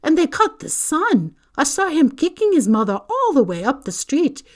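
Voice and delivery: loud, high, indignant tirade; outraged protest. A narrator's reading. McGonagall objects to Dumbledore leaving Harry there, outraged. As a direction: hammer every objection home.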